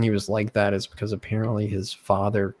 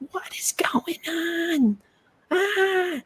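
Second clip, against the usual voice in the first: very high, slow mock screech; drawn-out high-pitched squeal of alarm; shrieking lunch lady; much slower than usual. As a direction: imitate a horrified lunch lady in falsetto. Imitating the horrified lunch lady.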